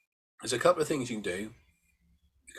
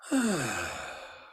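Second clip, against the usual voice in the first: double-breath sigh; 3 semitones higher than usual. Shown as a demonstration. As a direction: sigh with two breaths in, falling out. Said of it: "the double breath in".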